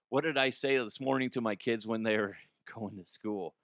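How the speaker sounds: ordinary talk, nothing marked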